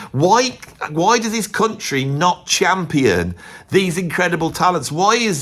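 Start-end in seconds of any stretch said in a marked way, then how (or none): none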